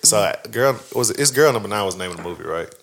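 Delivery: normal talking voice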